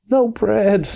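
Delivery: a gloomy mole lamenting the bare larder; doleful groan. A narrator's reading. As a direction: groan it dolorously. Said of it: "groaned the Mole dolorously".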